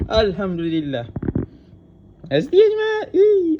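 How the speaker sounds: high, loud joyful cheering; excited shouting. Real, not acted. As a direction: yell it out in real delight. Gets the rare car.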